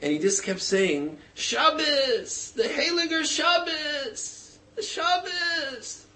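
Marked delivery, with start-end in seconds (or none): 1.3-6.0 high, slow, wailing through tears; an old sage weeping over the boy, crying out high and slow; tearful cry. Retold or imitated. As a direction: cry out the holy Shabbos, much higher, drawn out. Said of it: "the tears started rolling down his face".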